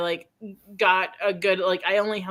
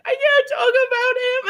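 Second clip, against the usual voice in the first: mock sob; 4 dB louder than usual. Quoting herself crying in kitchen.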